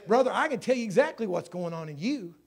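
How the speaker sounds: loud, driving sermon delivery; emphatic pulpit storytelling at full voice; impassioned preaching. Real, not acted. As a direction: preach it with rising force to a big climax.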